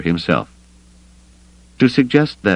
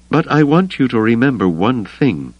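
higher-pitched, gravely serious woman's warning; earnest character voice, pitched up; deadly earnest; 7 semitones higher than usual. A narrator's reading. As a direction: lay down a condition in dead earnest. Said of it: "she said with deadly earnestness".

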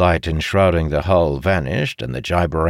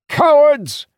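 very high, loud insult; enraged yell; 6 dB louder than usual. A narrator's reading. Captain yelling after those who hurried away, enraged. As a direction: hurl it after them with fury. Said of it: "Idara growled".

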